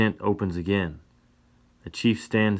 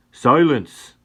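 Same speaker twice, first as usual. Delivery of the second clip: loud, high command; shout; 7 semitones higher, 11 dB louder than usual. A narrator's reading. Chief yelling back to silence Sam, loud command. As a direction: bellow to silence him. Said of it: "the chief yells back".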